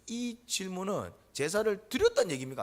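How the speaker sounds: first half of a rhetorical choice; rising option. Acted. Preacher poses the first option to the congregation.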